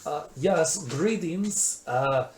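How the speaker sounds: lifted, put-on dwarf voice; dwarven shopkeeper. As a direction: greet customers as an eccentric dwarf. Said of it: "a dwarven man that's sort of balding".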